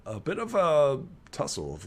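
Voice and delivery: normal talking voice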